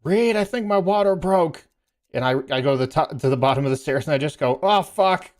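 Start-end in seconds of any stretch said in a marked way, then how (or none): 0.0-1.7 wife announcing. Retold or imitated. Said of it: "And she goes". 4.6-5.3 high, loud retold shocked "oh, fuck"; shocked outburst. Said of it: "natural, uncontrollable reaction".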